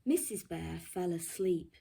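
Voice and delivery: ordinary delivery, nothing marked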